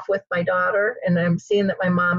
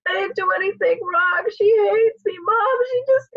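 high, upset daughter's plea; acted-out crying voice, pitched way up; imitated tearful wail; 13 semitones higher than usual. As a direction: imitate a distraught daughter wailing to her mother. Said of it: "her lips are quivering".

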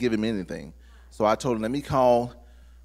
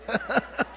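high laugh; 11 semitones higher than usual. Real, not acted. Laughs at own family joke.

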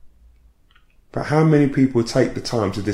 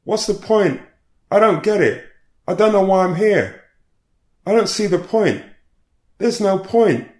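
high, loud, despairing cries; a distressed woman crying out in the hospital entrance, high and loud; cried out in distress; 10 semitones higher, 4 dB louder than usual. Retold or imitated. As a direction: cry out that nothing has a point. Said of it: "then cried".

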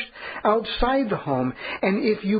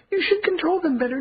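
imagined scolding; 10 semitones higher than usual. Retold or imitated. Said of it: "you think I'm gonna say".

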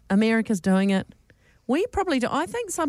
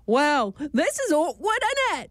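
mock British accent, slowed down; a hint of put-on British awkwardness; 7 semitones higher than usual. American on a date faking a British accent. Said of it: "you might say in a British accent".

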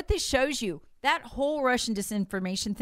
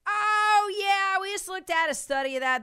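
mock breezy admission; 10 semitones higher than usual. Mocking Washington Post admission.

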